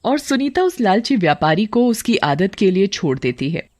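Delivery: ordinary delivery, nothing marked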